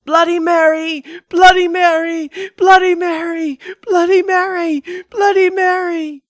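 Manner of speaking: high loud screamed chant; frantic screaming. A narrator's reading. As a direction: keep screaming the summons.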